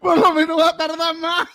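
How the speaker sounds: high voice. Pretend. Joking high slow line.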